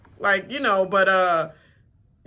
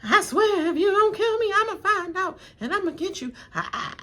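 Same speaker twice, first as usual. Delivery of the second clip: high, laughing mock threat; hammed-up tough-guy threat, half laughing; playful imitation of a threat; 12 semitones higher than usual. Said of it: "he was all like".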